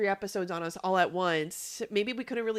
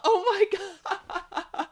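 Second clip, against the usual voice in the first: high, slow, laughing disbelief; laughing oh my God; 11 semitones higher, much slower than usual. Real, not acted. Cohost reacting to a surprise pick, laughing in disbelief. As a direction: stretch it out through real laughter.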